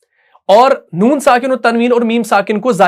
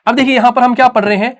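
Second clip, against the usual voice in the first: normal talking voice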